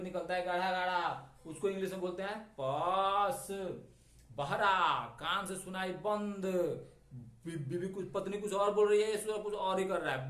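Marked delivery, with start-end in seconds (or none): none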